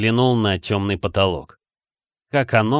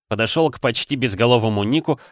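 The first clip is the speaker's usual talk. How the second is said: ordinary delivery, nothing marked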